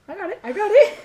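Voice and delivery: loud, high, giggling burst; laughing excitedly. Real, not acted. Laughing while tasting a bean.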